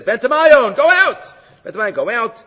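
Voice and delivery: high, loud command to the demon; commanding exorcism. Retold or imitated. The rabbi orders the demon out of the princess, high loud command.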